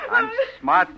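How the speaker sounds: gloating laugh. Acted. Captor gloats.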